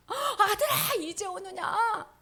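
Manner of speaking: high, slow, choked with joy; father embracing his lost son, tearful. Acted. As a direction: weep with relief as you hold him.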